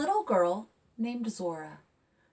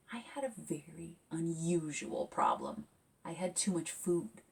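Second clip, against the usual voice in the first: whispered secret; hushed; 3 semitones lower, 6 dB quieter than usual. Real, not acted. Author confiding to young listeners in a hush. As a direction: lean in and hush the confession.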